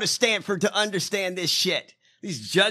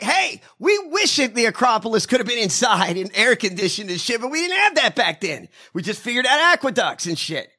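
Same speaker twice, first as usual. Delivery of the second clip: loud, high mock complaint; exasperated ancient Greeks; 5 semitones higher, 5 dB louder than usual. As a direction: shout back as indignant ancient Greeks. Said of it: "The Greeks are like".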